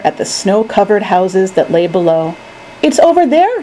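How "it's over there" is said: put-on higher, lightly excited child; eager pointing out. A narrator's reading. Charlie points out his house.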